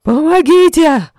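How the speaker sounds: very high, very loud, slow scream; terrified drunk screams for help. A narrator's reading.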